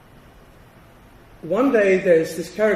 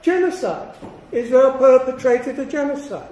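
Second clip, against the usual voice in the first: mocking quote; 6 semitones higher, 4 dB louder than usual. Mocking Abbas's UN accusation.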